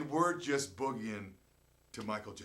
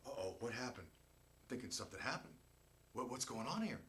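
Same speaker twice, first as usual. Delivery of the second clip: 1 wary puzzlement; 4 semitones lower, 10 dB quieter than usual. Retold or imitated. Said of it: "And I'm going".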